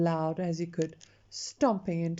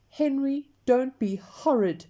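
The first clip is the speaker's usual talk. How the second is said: higher and louder, a scolding yell; 5 semitones higher, 6 dB louder than usual. A narrator's reading. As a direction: Mum yells at Henry to behave. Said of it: "yelled Mum".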